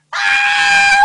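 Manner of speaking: very high, full-force scream; nightmare scream. Acted. Woman waking from dreamed cries for help, nightmare scream. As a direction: shriek awake in terror.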